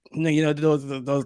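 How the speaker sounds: ordinary talk, nothing marked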